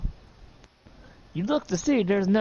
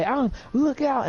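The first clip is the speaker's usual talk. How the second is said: much higher urgent warning shout; 10 semitones higher than usual. Acted. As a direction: yell the warning to Alan.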